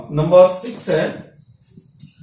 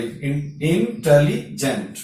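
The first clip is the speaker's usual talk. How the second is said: "Intelligent" slowly broken into three parts; syllable by syllable; 3 semitones lower, much slower than usual. Shown as a demonstration. Teacher counting parts for students, "intelligent" said slowly in pieces. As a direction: draw out "intelligent" with gaps between syllables. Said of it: "इसके तीन part हो गए".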